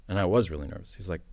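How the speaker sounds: ordinary delivery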